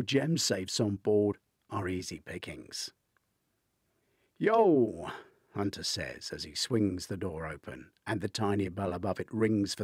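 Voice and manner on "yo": casual yo. A narrator's reading. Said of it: "louder and less formal".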